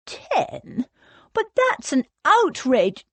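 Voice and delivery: high, indignant protest; outraged squawk. A narrator's reading. Cornelius objecting to being thrown out, outraged.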